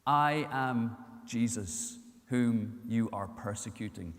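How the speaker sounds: calm, grave reply, low and unhurried; solemn voice of Jesus. Acted. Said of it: "the voice comes back and says".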